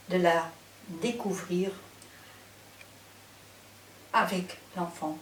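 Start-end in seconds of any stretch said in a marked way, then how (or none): none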